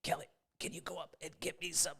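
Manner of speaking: dad's weird voice, very quiet and strained; hushed, squeezed pothead-dad impression; weird basement voice. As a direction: imitate a dad talking while holding in smoke. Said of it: "he would talk in a weird voice".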